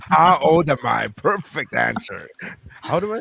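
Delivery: mock forgetful question through laughter; teasing mimicry.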